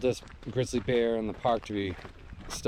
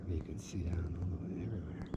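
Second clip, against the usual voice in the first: hushed whisper at a quiet lake; wildlife watching whisper; 4 semitones lower, 10 dB quieter than usual. Real, not acted. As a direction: whisper so the bears won't hear. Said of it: "I feel like I gotta whisper here".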